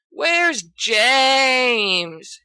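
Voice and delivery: loud whining call; bored loud whine; moaning complaint. A narrator's reading. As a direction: groan it out in exasperation. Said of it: "Sirius moans".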